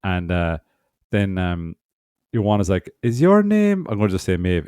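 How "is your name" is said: high clerk voice. Retold or imitated. Clerk calling name, voiced.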